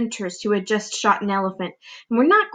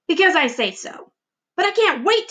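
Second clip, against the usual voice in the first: bickering aunts; 8 semitones higher than usual. Acted.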